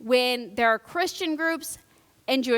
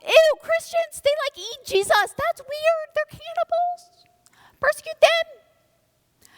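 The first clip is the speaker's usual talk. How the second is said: much higher, put-on disgusted voice; mock squeamish gossip; 6 dB louder, slower than usual. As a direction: squeal it like a grossed-out teen.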